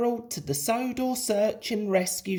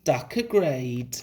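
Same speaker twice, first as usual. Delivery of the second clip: usual speaking voice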